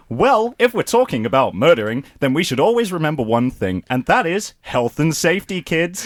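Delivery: upbeat cartoon voice for kids; cartoon host. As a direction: sell it like a kids' show presenter. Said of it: "Saturday morning cartoon".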